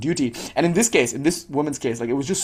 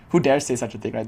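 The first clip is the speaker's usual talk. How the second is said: normal talking voice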